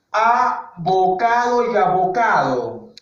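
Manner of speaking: slow, drawn-out ABOCADO Y ABOCADO; slow demonstration. Shown as a demonstration. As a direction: stretch both "abocado" so each sound is heard. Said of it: "Escucha desde ahí". (abocado y abocado, pronunciado lento y alargado)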